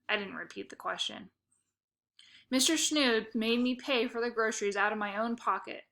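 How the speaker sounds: usual speaking voice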